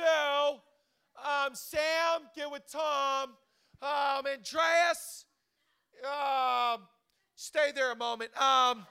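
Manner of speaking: lightly voiced coach pairing people up; a hint of an upbeat leader; God as team leader. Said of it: "he goes, Woo!".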